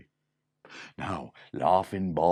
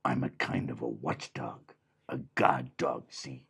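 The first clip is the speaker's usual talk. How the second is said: gruff watchdog; 8 semitones lower than usual. Acted. The dog says he will guard the door, low. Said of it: "And the dog said".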